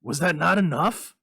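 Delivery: loud, high, fierce challenge; a husband confronting his wife; sharp angry question; fierce demand. A narrator's reading. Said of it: "he demanded fiercely".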